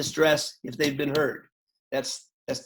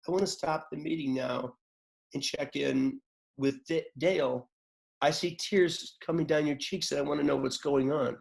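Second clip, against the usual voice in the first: much softer, gentle and quiet; gentle low-key; 6 dB quieter than usual. Shown as a demonstration. Facilitator gently checking in on a crying member, soft. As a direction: lower your energy, speak softly and gently. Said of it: "change your electron level to match".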